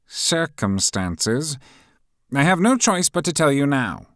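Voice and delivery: high, loud fussy professor voice; pompous professor taking charge; stern professor. A narrator's reading. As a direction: announce it like a pompous teacher.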